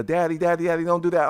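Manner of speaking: put-on a girl begging dad to stop; pleading daughter. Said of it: "my daughter like".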